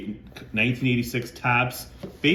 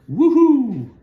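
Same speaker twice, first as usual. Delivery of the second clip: much higher, much louder whoop; excited woohoo; 12 dB louder than usual. Real, not acted. Collector cheering a blue Sharpie autograph, excited high loud whoop. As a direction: cheer like you hit the jackpot. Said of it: "gonna get a little excited".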